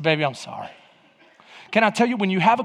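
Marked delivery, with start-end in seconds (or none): 0.0-0.7 soft, low mock apology; meek apology. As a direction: apologize small and low, playing the chastened husband. Acted sheepish apology to wife.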